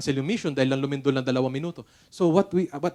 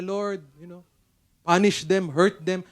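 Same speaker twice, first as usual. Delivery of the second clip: mock vengeful prayer; 2 semitones higher, 6 dB louder, slower than usual. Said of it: "imbis na mag-pray na".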